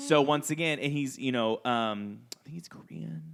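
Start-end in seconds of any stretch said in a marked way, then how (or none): 2.3-3.2 hushed whisper aside. Real, not acted. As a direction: whisper it under your breath.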